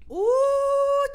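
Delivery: high, loud drawn-out "uh"; admiring whoop. Real, not acted. Cheering on a friend's big statement, admiring whoop. Cheers on the other speaker's remark.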